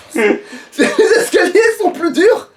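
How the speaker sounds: high, slow words through laughter; laughing joke. Real, not acted. Player joking to teammate about the stairs, laughing. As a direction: crack up at your own joke.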